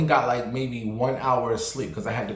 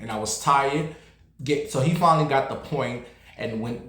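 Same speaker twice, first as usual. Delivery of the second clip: ordinary talk, nothing marked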